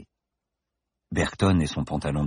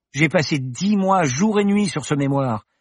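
put-on upset young woman; 9 semitones higher, 3 dB louder than usual. Paloma upset.

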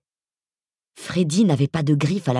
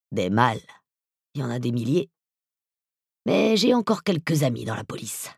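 voice from beyond the grave. Acted. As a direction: speak like a menacing ghoul. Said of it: "sa voix d'outre-tombe".

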